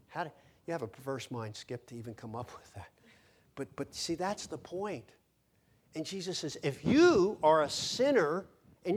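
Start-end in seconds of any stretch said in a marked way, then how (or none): none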